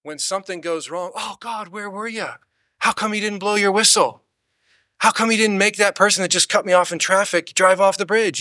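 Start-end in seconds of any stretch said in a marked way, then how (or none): 1.1-2.3 higher, much softer, plaintive mock lament; hushed self-pitying plea; whiny complaint to God. Believer complaining to God when things go wrong, soft plaintive whine. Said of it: "how many people treat God". 2.8-8.4 higher, aggrieved whining demand; mock indignant complaint. Believer blaming God for a traffic cutoff, higher indignant whine. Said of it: "how many people treat God".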